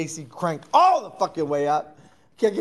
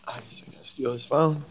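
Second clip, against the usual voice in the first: whisper; 3 semitones lower, 3 dB quieter than usual. Pretend. Scheming aside.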